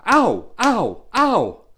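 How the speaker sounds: three high, loud ows; acted yelps of pain. Boy slapped by teacher's ruler, high acted yelps. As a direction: yelp sharply at each slap, acted.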